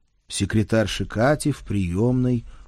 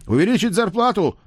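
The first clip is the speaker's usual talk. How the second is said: shouting; 12 semitones higher, 6 dB louder than usual. Acted. Men shout demands.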